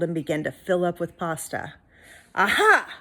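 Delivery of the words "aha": loud, high gloat; triumphant exclamation; triumphant aha. Acted. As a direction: crow with triumph. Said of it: "said Big Anthony".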